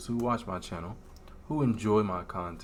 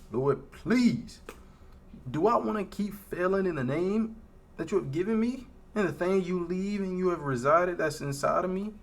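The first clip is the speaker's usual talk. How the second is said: raised, pleading voice near tears; real despair; 7 semitones higher than usual. Real, not acted. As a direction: beg God to take him home. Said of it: "I'm gonna be real with you".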